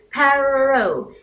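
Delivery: perro with a rolled double R; trilled perro. Shown as a demonstration. Teaching Spanish sounds, trilled perro. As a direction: roll the RR in perro hard. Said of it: "for the second R in Spanish for dog being".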